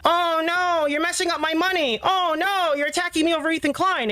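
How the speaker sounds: high-pitched mocking wail; mock whining. Streamer dismissing a petty complaint, mock whining. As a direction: whine it like an exaggerated complainer.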